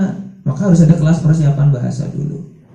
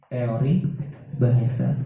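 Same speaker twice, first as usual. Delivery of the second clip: soft repeat; 5 semitones lower, 10 dB quieter, slower than usual. Shown as a demonstration. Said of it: "itu teori bahasa".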